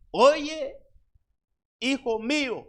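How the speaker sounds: llamado de madre, más agudo y fuerte; voz de madre. Acted. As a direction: una madre que insiste, subiendo el tono. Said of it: "Una madre". (una madre llama otra vez al hijo, más aguda)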